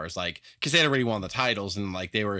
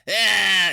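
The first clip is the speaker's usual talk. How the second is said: sudden loud growled ah; demon growl "Ah"; 8 semitones higher, 8 dB louder than usual. Retold or imitated. Smash cuts loose in his promo with a loud growled ah. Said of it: "Smash was like the".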